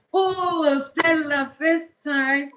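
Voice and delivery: high, drawn-out happy welcome; delighted greeting. Real, not acted. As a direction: sing out a thrilled hello to a newcomer. Greets a first-time live viewer.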